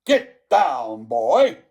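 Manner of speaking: loud, commanding headmaster; booming, no-nonsense command; stern order. A narrator's reading. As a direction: order a pupil down with force. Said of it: "Mister Coombs ordered".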